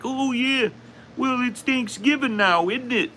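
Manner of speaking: high-pitched caricature of a cheery Canadian; hammed-up Canadian greeting, way up high; mock Canadian accent. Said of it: "Are Canadians like".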